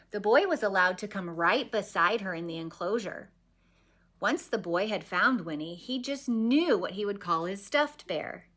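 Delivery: ordinary delivery, nothing marked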